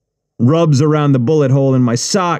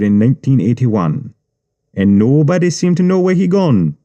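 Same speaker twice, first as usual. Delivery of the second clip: Jamaican gangster. Acted. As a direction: tease him with what you know. Jamaican gangster telling a story.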